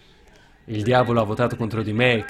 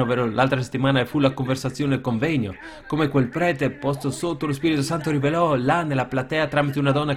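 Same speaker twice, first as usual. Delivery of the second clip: ordinary talk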